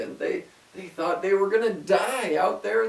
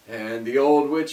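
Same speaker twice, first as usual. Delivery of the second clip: usual speaking voice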